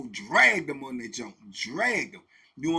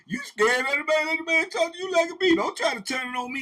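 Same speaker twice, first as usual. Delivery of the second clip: higher, laughing mock voice; mocking inmate; 8 semitones higher, 6 dB louder than usual. Inmate pushing the blame back, teasing and high. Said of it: "He said".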